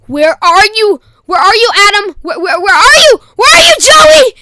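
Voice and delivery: high shouting that builds to a screech; escalating screamed search call; mock mom yelling. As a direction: imitate an angry mom hollering for hiding kids. Mom hunting for the boys.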